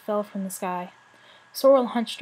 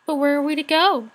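a higher, louder despairing cry; cried in despair; 5 semitones higher, 6 dB louder than usual. A narrator's reading. Young dragon crying out to the elders in despair. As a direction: plead it out, voice lifting in despair. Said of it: "cried one of the dragons in despair".

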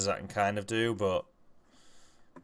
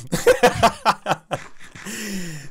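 loud, high burst of laughing; long, high-pitched cackle of amusement; real laughter; 11 semitones higher, 9 dB louder than usual. Real, not acted. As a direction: crack up at your own impression. Cracks up at own impression.

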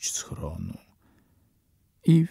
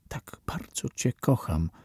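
put-on softer, tender girl's voice; tender whisper; 2 semitones higher, 6 dB quieter than usual. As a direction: whisper it lovingly, close to him. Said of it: "szepnęła dziewczyna". (dziewczyna czule szepcze chłopcu)

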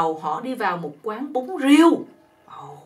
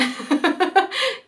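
high laugh; giggle; 8 semitones higher than usual. Real, not acted. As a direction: laugh at the cheeky poem. Laughs at the joke.

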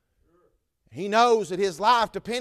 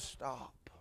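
whispered stop; hushed cry; 6 semitones lower, 18 dB quieter, slower than usual. Acted. Paul stops the jailer, hushed whisper. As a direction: breathe the word stop. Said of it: "He cries".

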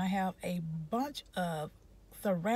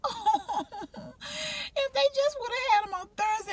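whiny lament; 13 semitones higher than usual. Retold or imitated. Retelling her own upset wish.

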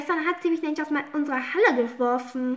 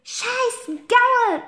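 high, loud curse at the horse; girl cursing her horse; angry shout; 9 semitones higher, 4 dB louder than usual. Acted. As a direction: spit it out furiously.

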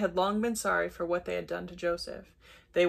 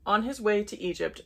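usual speaking voice

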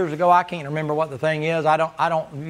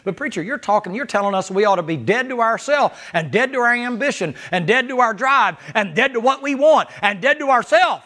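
objecting congregant; 6 semitones higher, 3 dB louder than usual. Retold or imitated.

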